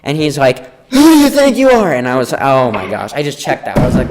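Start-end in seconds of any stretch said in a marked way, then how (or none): 0.9-1.9 principal yelling. Retold or imitated. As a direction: imitate an outraged principal screeching. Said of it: "he just keeps yelling at me".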